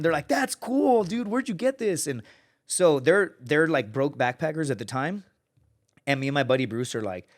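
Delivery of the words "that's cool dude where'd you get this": mock excited dude. Backpackers loving the video.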